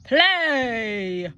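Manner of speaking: loud booming command; giant roar. Acted. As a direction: roar the single word. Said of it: "roared the giant".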